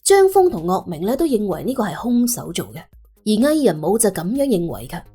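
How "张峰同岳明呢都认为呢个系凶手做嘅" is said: usual speaking voice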